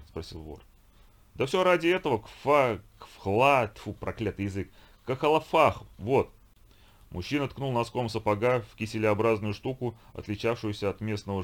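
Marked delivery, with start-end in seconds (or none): 1.4-6.3 громко, с трудом выговаривая; сплюнь, запутавшись в слове; викинг спотыкается о слово. Acted. Викинг ломает язык на слове. (викинг с трудом выговаривает)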